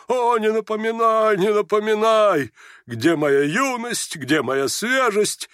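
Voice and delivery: slow, high, drawn-out lament; theatrical old general crying out. A narrator's reading. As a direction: declaim it with long wailing vowels. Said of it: "кто это вскричал". (протяжный театральный возглас старого генерала)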